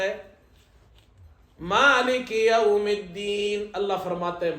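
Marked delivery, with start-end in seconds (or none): 1.6-3.7 very slow, melodic chanted verse; Quran recitation. Shown as a demonstration. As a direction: draw out each word in tajwid chant.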